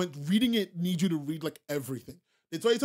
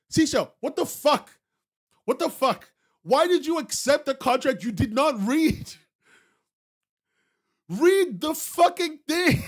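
high, loud exasperated scolding; exasperated yelling; 7 semitones higher, 5 dB louder than usual. Real, not acted. Streamer scolding a chatter who signed unread, yelling. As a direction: let it rip at the chatter.

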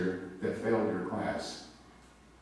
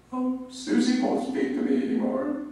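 put-on much higher, louder weepy girl voice; crying little girl; 6 dB louder than usual. Seventh-grade girl complaining to teacher about a friend, high whiny. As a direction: wail like a dramatic seventh grader. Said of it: "a little girl that was crying".

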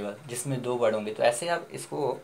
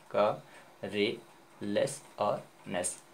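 का, रे, लेस और नेस slowly, each apart; broken into syllables; 3 semitones lower, 4 dB quieter, much slower than usual. Shown as a demonstration. Teacher recapping the broken word, का, रे, लेस, नेस slow and separate. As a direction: separate का, रे, लेस, नेस with small pauses, softening. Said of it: "तो ये क्या, टूट के".

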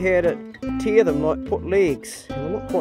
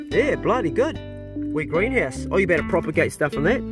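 usual speaking voice

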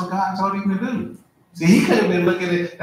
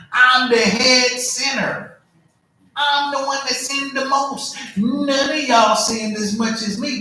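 boastful Paul; 7 semitones higher, 4 dB louder, slower than usual. Retold or imitated. Boasting Paul quoted in sermon.

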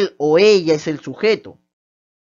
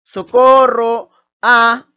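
slow, stretched call of socorro a, louder; drawn-out cry for help; 3 semitones higher, 4 dB louder, much slower than usual. Shown as a demonstration. Teacher demonstrating a cry for help, drawn out. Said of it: "que es una interjección".

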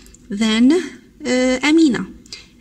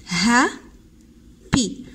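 "ها ... بي" with a clear pause between; split into syllables; much slower than usual. Shown as a demonstration. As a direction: say "ها", stop, then "بي". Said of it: "وهذا سيلابول".

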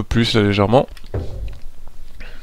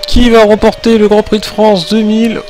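excited, higher race play-by-play; motorsport commentator; 7 semitones higher than usual. Pretend. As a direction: build the suspense of a photo finish. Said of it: "On va faire monter le suspense".

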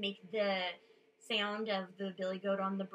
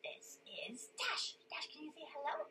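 whisper; 5 semitones higher, 6 dB quieter, faster than usual. Real, not acted. Whispering to sleepy toddler.

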